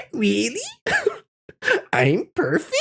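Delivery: squeaky, very high "aw, shucks" voice; mock-flattered, high-pitched cartoonish voice; silly put-on voice. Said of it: "I'll never do that voice ever again".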